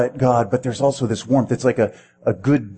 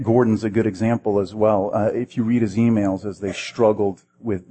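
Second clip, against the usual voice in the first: ordinary delivery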